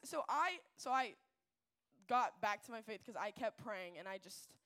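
ordinary delivery

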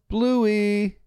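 high, drawn-out mock yell; calling from afar. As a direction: imitate a mom calling the kids in to dinner. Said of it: "she yells".

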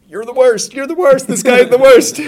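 high, loud cry of outrage; viewer's outburst. Acted. Film fan cursing the villain on screen, high indignant outburst. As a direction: exclaim it high and hard at the villain.

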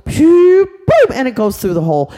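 loud, high burst of excitement; excited narration. A narrator's reading. Storyteller cheering the boy's ball into the hole, loud and excited.

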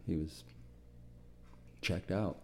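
hushed and heavy with real grief; barely able to say it about a lost friend; choked up. Real, not acted. Veteran recalling his mentor, quietly choked up. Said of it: "sorry about being emotional".

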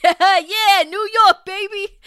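high, loud brash New Yorker; Will Sasso New York bit. Retold or imitated. Podcaster quoting a Will Sasso bit in loud New York voice. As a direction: belt it like a cartoon New York guy. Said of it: "that bit from Will Sasso where he's like".

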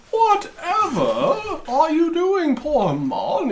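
high, slow, fussy voice; a prim talisman scolding its owner; shocked talisman voice. Acted. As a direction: gasp it out like a scandalised trinket.

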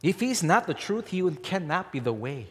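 normal talking voice

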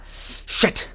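a breathless, voiceless curse of pain; hissed curse in pain. Acted. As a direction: spit the curse out under your breath. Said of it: "All ten knuckles skin now".

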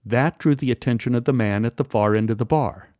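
ordinary delivery

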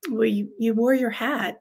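usual speaking voice

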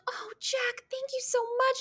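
very high, soft wheedling imitation; Chrissy. Co-host mocking the blonde's wiles, high soft coo. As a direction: mimic a girl sweet-talking a man, high and airy. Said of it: "I know this type of girl".

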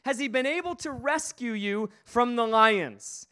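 high, strained cry of a distressed king; anguished call. Acted. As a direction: call out in anguish toward the den. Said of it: "and he says it again".

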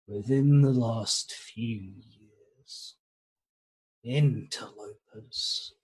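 a hint of an ancient serpent, slow; deep slow snake voice. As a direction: speak slowly like a very old snake. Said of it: "what passes as a deep snake voice, uh, and kind of slow".

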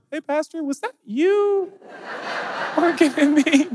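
very high, slow, teasing voice; high teasing churchgoer, laughing; mock congregant. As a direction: catch the pastor out, laughing. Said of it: "do that in Kirkland".

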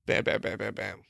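breathy whispered bam bam. Pretend. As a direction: whisper the bams under your breath. Miming quick swiping.

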